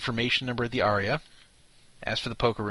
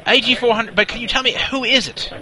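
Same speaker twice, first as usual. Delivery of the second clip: pompous British colonel, higher and louder; Colonel Nigel Fabersham; 9 semitones higher, 6 dB louder than usual. Acted. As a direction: bluster like an indignant old officer. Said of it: "Colonel Nigel Fabersham here".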